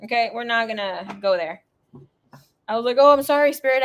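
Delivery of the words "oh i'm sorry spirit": higher, louder startled sorry; jolted apology to a spirit, higher and louder. Retold or imitated. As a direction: caught off guard, rush the apology.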